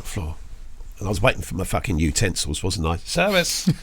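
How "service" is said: a sudden high, cheeky call for the waiter; mock call for service. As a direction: pretend to summon a waiter with a jokey yell.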